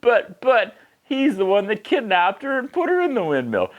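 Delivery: high, indignant sputter; hammed-up indignation; mock outrage. Real, not acted.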